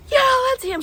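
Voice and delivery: high, breathy giddy reply; giddy confirmation. Retold or imitated. Confirming to her friend, high and giddy. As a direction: say it giddy with awe.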